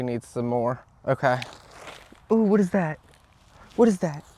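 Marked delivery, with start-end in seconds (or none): 2.3-4.2 sudden alarmed high question; startled. Real, not acted. Vlogger spots a flying insect, startled. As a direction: jump at something flying past.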